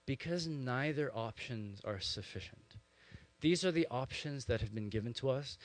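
ordinary talk, nothing marked

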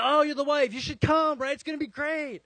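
hammed-up gushing invitation, high and loud; over-eager surgeon, imitated. Surgeon inviting the patient's wife to watch surgery, hammed-up enthusiasm. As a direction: play an overexcited host, pitch way up.

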